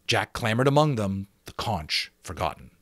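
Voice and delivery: plain reading voice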